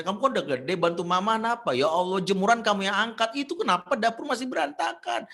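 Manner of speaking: loud, high, rapid-fire nagging; nagging mother. Retold or imitated. Busy working mother nagging her grown child about chores, loud scolding. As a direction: scold like a mother piling up complaints. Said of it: "yang ada ngomel".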